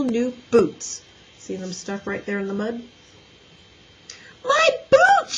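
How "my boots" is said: put-on very high, loud child's wail. A narrator's reading. Little girl losing her new boots, wailing. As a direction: cry out in shrieking dismay. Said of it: "she wailed".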